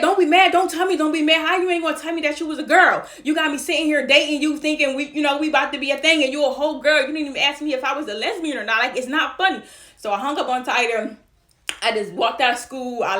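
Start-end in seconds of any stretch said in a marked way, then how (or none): 0.0-9.6 heated, higher-pitched scolding; angry confrontation. Retold or imitated. Girl confronting boyfriend over the phone, angry. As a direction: tell them off, raised and indignant.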